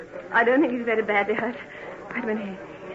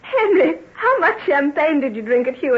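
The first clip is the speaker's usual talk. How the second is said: surprised cry; 4 semitones higher, 5 dB louder than usual. Acted. Shocked at his strange mood.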